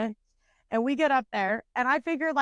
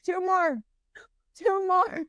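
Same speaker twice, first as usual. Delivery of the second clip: high, drawn-out call; imitated ride operator; 8 semitones higher, slower than usual. Operator calling out to her.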